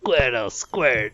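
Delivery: slow drawn-out Pokemon call; silly Pokemon voice. Acted. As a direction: stretch it like a cartoon creature.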